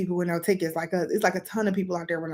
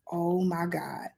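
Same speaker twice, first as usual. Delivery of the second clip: hushed whispered dismay; 2 semitones lower, 6 dB quieter, slower than usual. Retold or imitated. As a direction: breathe it out in horror. Friend realizes mistake.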